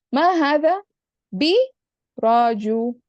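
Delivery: ma hada, bi, rajulin in loud, separate pieces; word by word. Shown as a demonstration. Teacher writing out a negated example for the student, word by word. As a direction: break it up with pauses: "ma hada ... bi ... rajulin".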